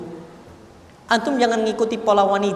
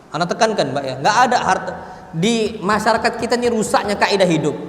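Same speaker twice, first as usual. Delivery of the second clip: ordinary talk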